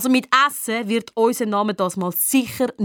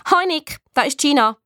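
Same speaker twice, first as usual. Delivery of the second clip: put-on higher, irritating girl on the phone; annoying Gina; 5 semitones higher than usual. A narrator's reading. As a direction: greet in a grating classmate's voice. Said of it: "tönt am Telefon noch nerviger". (nervige Mädchenstimme)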